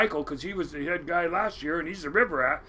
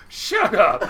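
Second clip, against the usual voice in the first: louder, incredulous retort breaking into laughter; mock-outraged and laughing; 2 semitones higher, 7 dB louder than usual. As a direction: blurt it out loud through a laugh. Retort in a retold exchange, laughing.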